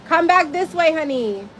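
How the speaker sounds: calling out to daughter. Real, not acted.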